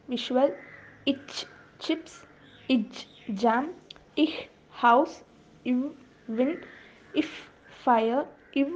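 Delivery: each sound like "ish" alone, then its example word like "shop"; isolated consonant sounds. Shown as a demonstration. Teacher listing consonant examples, "ish" then "shop", each sound separated from its word. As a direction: recite sound, pause, word: "ish, shop", "ich, chips", "ib, ball". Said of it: "Examples of consonants are".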